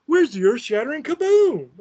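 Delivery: squeaky put-upon cartoon complaint; Marvin the Martian. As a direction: comedian quoting a cartoon Martian. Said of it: "remember Marvin the Martian?".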